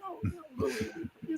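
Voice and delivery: barely voiced in-character aside; Abbott's sotto voce. Straight man covertly cueing his partner, hushed. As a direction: murmur it very softly, staying in character. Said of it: "he's in character".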